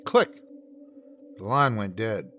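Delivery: plain reading voice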